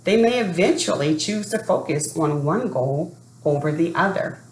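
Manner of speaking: plain reading voice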